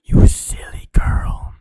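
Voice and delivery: slow, breathy whispered tease; teasing whisper. Pretend. Streamer whispers a tease to a friend, slow and close. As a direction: hiss it close to the mic.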